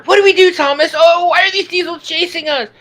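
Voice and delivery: high worried Percy. Retold or imitated. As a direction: fret like a frightened little engine. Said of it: "Percy's lines are".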